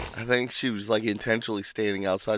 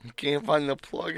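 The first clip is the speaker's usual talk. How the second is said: ordinary talk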